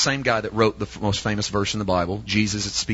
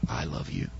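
tender Father; 9 semitones lower, 12 dB quieter than usual. Acted.

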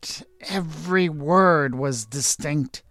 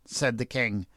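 ordinary delivery, nothing marked

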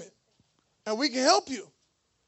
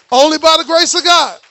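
much louder declaration of faith; loud proclamation; 11 dB louder than usual. Acted. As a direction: call it out strong to the church. Preacher repeats line, loud then soft.

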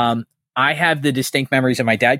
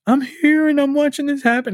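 much higher, put-on voice of a distraught friend; mock Kelly voice. Retelling Kelly's call to a friend, high mock voice. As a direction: squeak it out like the upset friend on the phone. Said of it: "And Kelly was like".

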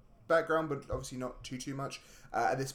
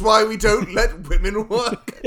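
loud, slow, higher mock chauvinist pronouncement; mock sexist blowhard; 7 semitones higher, 14 dB louder, slower than usual. Mocking the film's sexist men, loud slow proclamation. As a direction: boom it out like a smug old-fashioned man.

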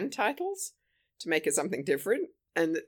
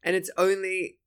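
usual speaking voice

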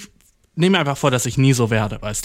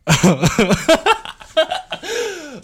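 guilty laugh; 13 semitones higher than usual. Real, not acted. Laughing about skipping work.

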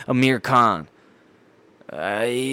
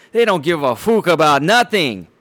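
mock Irish accent, a bit louder; McGregor Irish accent; 3 semitones higher, 4 dB louder than usual. Scoffing at the British in a mock Irish accent. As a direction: ham up a brash Irish accent for one line. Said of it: "an Irish kinda accent, uh, a McGregor accent".

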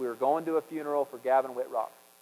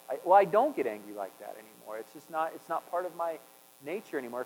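ordinary delivery

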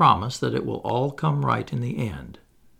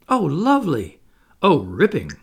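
put-on high, loud girlish delight; Wendy flying, calling out in rapture; thrilled; 3 semitones higher, 4 dB louder than usual. A narrator's reading. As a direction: bubble over with joy.

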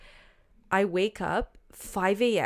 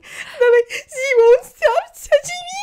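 high, loud, sobbing words; crying wail; 9 dB louder than usual. Retold or imitated. Sobbing to friends in the jacuzzi, crying loudly. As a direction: wail it out through tears, pitched way up.

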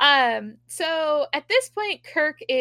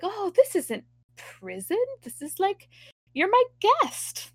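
higher, slowed-down host voice, lightly put on; sing-song captor, higher and slow; mock welcoming captor; 8 semitones higher than usual. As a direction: play the smug host greeting his prisoners.

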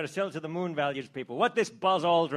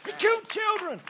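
mock outrage.

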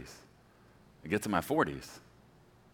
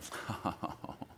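soft giggle; 8 dB quieter than usual. Retold or imitated. God giggling from heaven.

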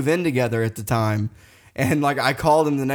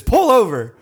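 higher, louder mock fight threat; retold drunken challenge; 7 semitones higher, 5 dB louder than usual. Daring his best friend to fight from the truck, mock belligerent. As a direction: call him out loudly, playing it up.

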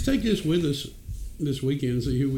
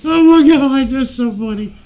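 loud high burst of laughter; laughing loudly; 9 semitones higher, 11 dB louder, slower than usual. Real, not acted. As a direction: crack up at a friend's pain. Laughing at friend's pained face.

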